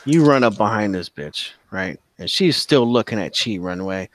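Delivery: normal talking voice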